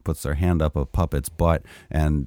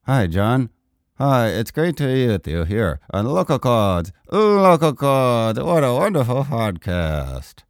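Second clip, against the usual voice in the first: ventriloquist voice, lips still; lips barely moving; 4 semitones higher than usual. Shown as a demonstration. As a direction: talk without moving your lips. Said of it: "Did my lips move?".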